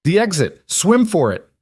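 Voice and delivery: high, loud urgent shout. A narrator's reading. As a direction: yell it across the pool. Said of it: "Sienna shouted".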